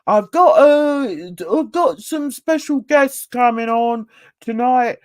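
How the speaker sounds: loud, botched Yorkshire imitation; failed Yorkshire accent. Mimicking a streamer announcing special guests, botched Yorkshire accent. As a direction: have a go at Yorkshire and miss. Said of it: "that isn't a Yorkshire accent".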